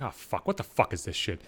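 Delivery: low, very soft inward groan of words; quiet, low retold thought; dreading aside. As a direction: say it under your breath, dreading it. His inward dread at her approach.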